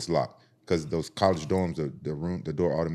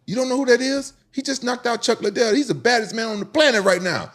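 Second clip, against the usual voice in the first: much higher and louder, disbelieving; incredulous hype; 5 dB louder than usual. Retold or imitated. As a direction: hype up the champ in shock.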